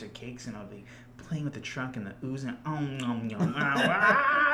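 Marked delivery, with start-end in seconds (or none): 2.7-4.6 eating noises. Retold or imitated. Pretending to eat ooze cakes.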